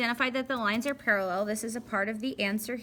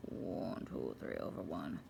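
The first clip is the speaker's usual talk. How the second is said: much lower and softer, counting under her breath; graphing voice; 11 dB quieter than usual. Shown as a demonstration. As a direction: drop way down and murmur the count. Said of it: "That's my graphing voice".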